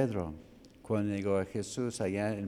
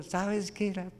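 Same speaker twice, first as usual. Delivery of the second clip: higher, half-mocked retort; a hint of Peter snapping back, pitched up; Peter cursing; 8 semitones higher than usual. Peter answering the bystander, higher mock retort. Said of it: "Y él dijo".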